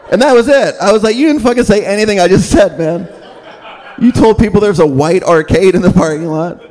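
high, outraged disbelief; exasperated. Retold or imitated. Comic to the host who garbled the message, in exasperated outrage.

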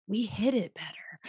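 whispered like a secret; joking whisper. Retold or imitated. Husband whispering a joke to his wife, secret whisper. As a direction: hush it as a conspiratorial joke. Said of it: "And Kirby's like".

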